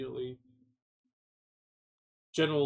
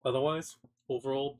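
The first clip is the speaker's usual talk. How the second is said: usual speaking voice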